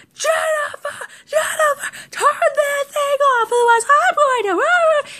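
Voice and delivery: very high, wailing mock rage; insanely angry. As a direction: imitate a brother screeching at his sister. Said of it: "starts getting insanely angry".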